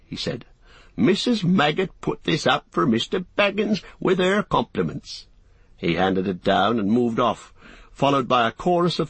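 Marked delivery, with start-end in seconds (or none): 1.0-5.3 farmer voice. Acted.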